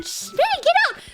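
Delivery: high, urgent mock shushing; irritated mom. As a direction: imitate a busy mother sending a kid away. Acting out busy mom shooing her.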